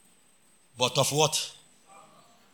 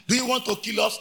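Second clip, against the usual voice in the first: normal talking voice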